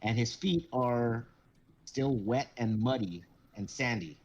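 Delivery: ordinary delivery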